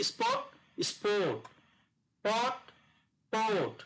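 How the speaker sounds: "স্পট" short, "স্পোর্ট" longer rounded o; word pairs for a phonics class; vowel contrast pairs. Shown as a demonstration. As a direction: say "পট" clipped, then "পোর্ট" with a longer o. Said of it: "যেমন অ, ও".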